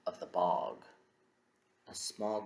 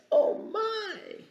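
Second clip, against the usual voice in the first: squeaky oh my; 7 dB louder than usual. Acted. Story rhyme, squeaky exclamation.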